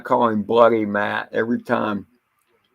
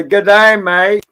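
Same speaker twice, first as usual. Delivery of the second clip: high, loud amateur Aussie greeting; attempted Australian accent; 8 semitones higher, 8 dB louder than usual. Greeting Mad Kiwi with an attempted Australian accent. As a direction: have a rough go at an Australian accent. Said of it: "or Australian accent".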